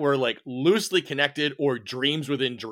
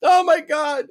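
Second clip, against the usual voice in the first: laughing; 7 dB louder than usual. Real, not acted. Host recalling the cannibal episode, laughing hard. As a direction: laugh your way through the line.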